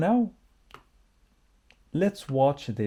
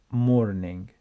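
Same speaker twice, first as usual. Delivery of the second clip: normal talking voice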